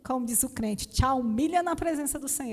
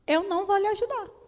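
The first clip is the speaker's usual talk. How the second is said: curt refusal; 6 semitones higher than usual. Retold or imitated.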